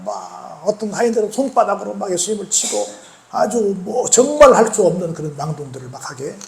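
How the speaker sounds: usual speaking voice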